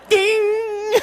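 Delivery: a high laughing imitation of a timer bell; mock bell ding. Imitating a student timing his answer, high laughing ding. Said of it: "he's going".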